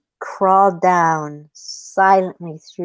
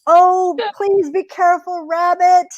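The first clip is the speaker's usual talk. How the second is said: high, worried plea; pleading coyote; 10 semitones higher than usual. Acted. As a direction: beg in a raised storybook voice.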